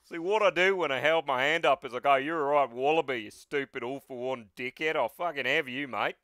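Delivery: broad Aussie pub threat; swaggering Australian hero mouthing off; heavy Australian accent. Acted. All Might insulting the villain, heavy Australian accent. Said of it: "Heavy Australian".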